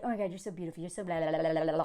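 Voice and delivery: low, quiet rattled-off imitation; mocking flattery. Tinder men messaging her, low mocking flattery. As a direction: mumble the gushing compliment dismissively, low and soft. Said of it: "they'd be like".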